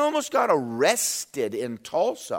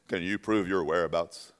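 lower, quieter detective voice; gruff detective; 11 semitones lower, 6 dB quieter than usual. Retold or imitated. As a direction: ask it like a stern cop. Detective questioning him.

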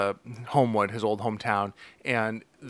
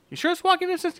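squeaky high mock question, pitched way up; faster than usual. Cohost parodying a TV character's question, high mock voice.

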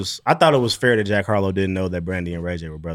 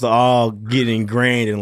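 ordinary talk

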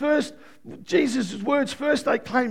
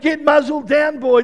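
shouted rebuke; 4 semitones higher, 8 dB louder than usual. Retold or imitated.

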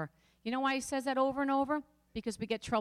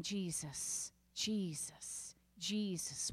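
softer each time until a whisper; fading to a whisper; 4 semitones lower, 9 dB quieter, much slower than usual. Retold or imitated. Calming someone hysterical, fading into a whisper. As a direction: let Jesus fade into a whisper. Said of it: "Start saying".

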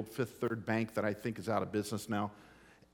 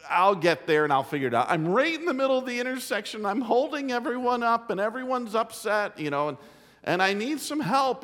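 much higher, louder and fast pleading; imagined daughter; 9 semitones higher, 5 dB louder, faster than usual. Retold or imitated. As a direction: play her panicked plea, rushed and high. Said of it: "I'm thinking".

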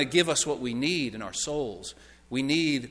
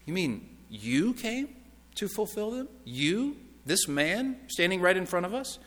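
softer, halting, with rising question endings; incredulous questioning; 3 semitones higher, 5 dB quieter than usual. Acted. Hearers questioning the man standing before them, incredulous. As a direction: break it up in disbelief, lifting each phrase. Listeners' disbelief at Jesus' claim.

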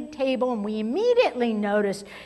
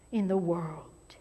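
hushed emphatic whisper; 8 semitones lower, 9 dB quieter than usual. Real, not acted. Preacher whispers the key point to the congregation. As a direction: drop to a whisper to drive it home.

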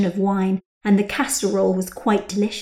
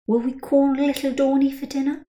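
higher, sugary voice; sweet Rachel; 6 semitones higher than usual. A narrator's reading. Rachel buttering up her friend about the child, sugary. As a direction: coax sweetly.

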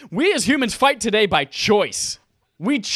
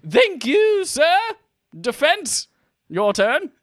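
high, put-on judge voice; mock judge; 9 semitones higher, slower than usual. Judge handing over to the defense, high put-on voice. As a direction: pitch it way up as a formal courtroom judge.

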